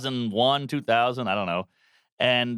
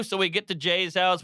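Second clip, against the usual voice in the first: ordinary talk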